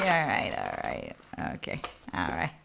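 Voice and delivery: very low, softened mock concession; acted low, trailing resignation; grudging acceptance. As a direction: drop right down and give in. Acted grudging reply in retold exchange.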